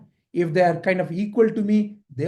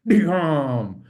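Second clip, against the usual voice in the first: long vocal boom; mimicked thunderclap; 3 semitones lower than usual. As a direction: crash like sudden thunder. Imitates thunder crash.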